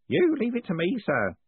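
ordinary delivery, nothing marked

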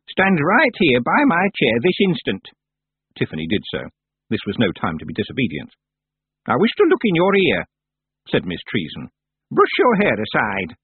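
put-on high, sharp old witch voice; imperious blind old witch; stern old witch. Miss Treason voiced.

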